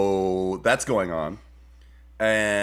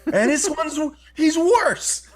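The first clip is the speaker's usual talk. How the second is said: news anchor voice; 5 dB louder, slower than usual. Pretend.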